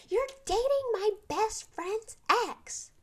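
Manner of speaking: high, loud and slow, hammed-up accusation; mocking dramatic girl. Retelling the new girl's hallway accusation, mock dramatic shriek. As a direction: mimic her overblown outrage, arm flung out. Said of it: "flung her arm out and she was like".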